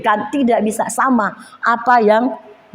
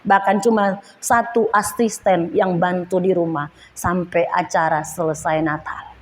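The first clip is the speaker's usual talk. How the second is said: ordinary delivery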